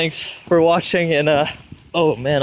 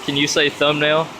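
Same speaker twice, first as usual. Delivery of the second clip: ordinary delivery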